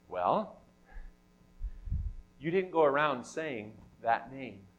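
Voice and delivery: ordinary talk, nothing marked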